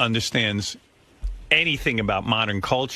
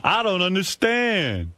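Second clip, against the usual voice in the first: higher, slowed bafflement; mock confusion; 7 semitones higher, much slower than usual. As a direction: play dumb, drawn out and high. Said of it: "it's like".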